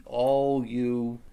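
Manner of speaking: drawn-out, loud "all you"; addressing the troops. Acted. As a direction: call out to a whole army, stretching it. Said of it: "stand up and say".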